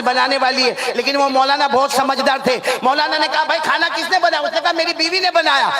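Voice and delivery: high, carrying sermon storytelling; preacher telling a parable. Acted. Preacher tells the crowd of a maulana invited to eat, rousing storytelling. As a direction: tell it to a crowd, pitch lifting as it goes.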